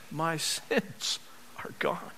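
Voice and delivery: soft, breathed-out assurance; hushed relief. Real, not acted. Believer answering fear of a failed business, hushed relief. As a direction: drop softly after the worry, with a pause.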